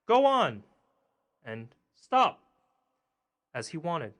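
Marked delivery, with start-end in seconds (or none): 0.0-0.6 shouted high and loud at the horse; sharp cry of command; calling out. A narrator's reading. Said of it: "crying out". 1.9-2.4 shouted high and loud at the horse; sharp cry of command; calling out. A narrator's reading. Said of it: "crying out".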